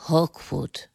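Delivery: low, soft murmur. A narrator's reading. Hermit supplies the Englishman's real name, murmuring. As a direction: drop the name under your breath. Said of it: "murmelte William Fleet".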